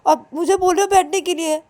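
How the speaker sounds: put-on high, sharp scolding girlfriend voice; angry scolding. Payal scolds Ananya for not opening the door, angry. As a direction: tell him off for forgetting her.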